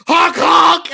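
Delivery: high loud clown honk shout; silly clown. Retold or imitated. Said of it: "and I go".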